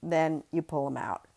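ordinary delivery

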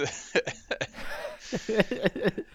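breathy burst of real laughter; laughing. Real, not acted. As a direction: laugh it out, caught off guard. Bursts out laughing at own story.

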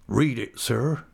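understated shaken rustic voice; a touch of a tremble; low, shivering voice. Acted. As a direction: ask with restrained dread. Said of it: "he said in a low, shivering voice".

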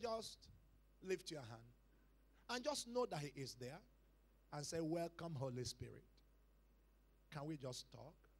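soft, low, unhurried coaxing; gentle instruction. Retold or imitated. Priest teaching the preacher how to welcome the Spirit, gently. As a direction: quietly guide someone into prayer, pausing between phrases.

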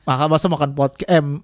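talking while eating noodles; words around a mouthful; mouth full. Real, not acted. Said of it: "ngomong di podcast sambil makan mie".

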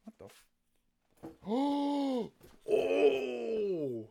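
startled gasp and cries at a spider; freaked out. Real, not acted. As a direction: gasp and cry out in real fright. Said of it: "I'm freaked out now".

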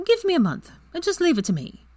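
grinning and sure; Ginny promises friends, playfully confident. A narrator's reading.

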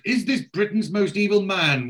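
high, dramatic mock headline; scandalised posh lady. Lady quoting the Sunday papers, scandalised. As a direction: read it out like a shocked society lady. She continues.

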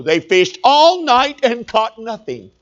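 ordinary talk, nothing marked

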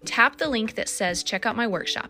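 upbeat sponsor read over music. Acted. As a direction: sell it like a friendly host.

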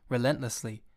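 usual speaking voice

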